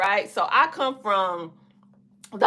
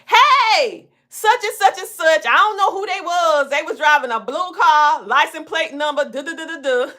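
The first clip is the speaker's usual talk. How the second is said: excited neighbor report; 8 semitones higher than usual. Retold or imitated. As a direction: rattle off the news like a watchful neighbor. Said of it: "your neighbor say".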